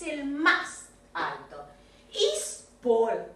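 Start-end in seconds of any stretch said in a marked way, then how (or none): 2.1-3.3 Is Paul the tallest, spoken slowly with a rising end; teacher asking the student to repeat; slow model question. Shown as a demonstration.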